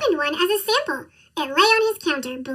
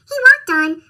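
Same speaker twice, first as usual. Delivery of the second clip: excited; 7 semitones higher, 8 dB louder than usual. A narrator's reading. Cheerful narration of walking off.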